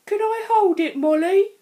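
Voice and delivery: begging to hold it, high and loud; a classmate pleading to hold the crystal, high and loud; Aaron. A narrator's reading. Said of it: "said Aaron".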